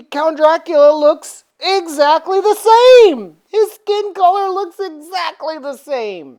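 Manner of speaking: high, loud comic insistence; mock insistence. Narrator joking to viewers about Dracula's looks, mock insistence. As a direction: hammer the claim with rising pitch.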